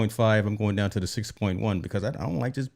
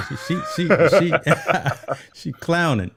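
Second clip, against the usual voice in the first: breaking up with laughter, higher pitch; laughing; 7 semitones higher than usual. Real, not acted. Teasing cohost over her slip, laughing. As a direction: stammer it through real laughter.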